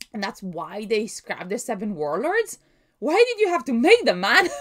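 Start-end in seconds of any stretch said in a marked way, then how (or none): 3.0-4.5 higher and louder, laughing through a mock Usopp complaint; laughing Usopp quote. Reading Usopp's line aloud, laughing and mock-complaining. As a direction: toss off Usopp's whine while laughing. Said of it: "says Usopp".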